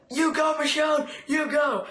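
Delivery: high-pitched cheering for a hero; excited cheer. Retold or imitated. Fan cheering Michonne's katana kill on screen, high excited cheer. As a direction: whoop her on like a fan at a game. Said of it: "I was like".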